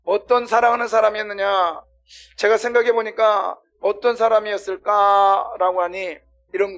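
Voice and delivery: loud, high, emphatic sermon delivery; impassioned, projected pulpit voice; forceful preaching. Real, not acted.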